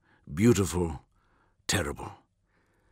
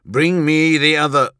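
loud, higher angry command, cut off; 7 semitones higher, 6 dB louder than usual. A narrator's reading. Vulcan ordering his equerry, angry outburst. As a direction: burst out with the order, then break off. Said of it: "Vulcan grew angry".

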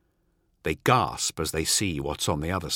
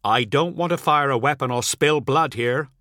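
raised, firm warning; firm, higher-pitched warning; 5 semitones higher, 4 dB louder than usual. A narrator's reading. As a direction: warn them off in a higher voice. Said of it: "Ol warns".

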